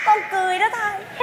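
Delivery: high, soft sobbing laughter; tearful giggle squeezed through the words; crying laugh. Acted. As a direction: laugh so it sounds just like crying. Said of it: "Sao bà khóc cười vậy? Có một giọng vậy?".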